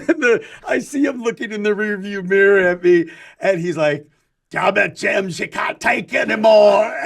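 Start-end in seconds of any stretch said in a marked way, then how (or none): 4.5-6.9 loud, hammed-up Scottish brogue; Scottish accent. Taxi driver obliges a passenger's catchphrase request, broad Scottish accent. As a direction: belt it out in an imitated Scottish accent. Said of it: "does this beautiful Scottish accent".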